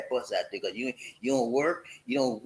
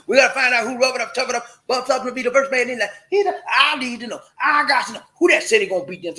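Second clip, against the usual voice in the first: hyped call; 8 semitones higher, 7 dB louder, faster than usual. Acted.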